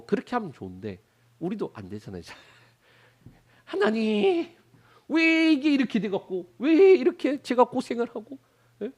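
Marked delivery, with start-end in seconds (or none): 3.6-8.4 higher, louder nagging complaint; nagging prayer. Retold or imitated. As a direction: nag God like a scolding wife. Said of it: "하나님 앞에 바가지 긁고". (하나님께 바가지 긁듯 높고 크게)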